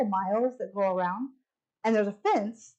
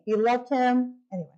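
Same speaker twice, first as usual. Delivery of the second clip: normal talking voice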